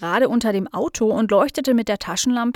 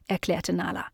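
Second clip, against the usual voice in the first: plain reading voice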